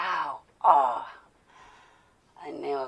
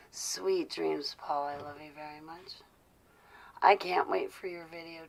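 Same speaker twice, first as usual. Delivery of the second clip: ordinary delivery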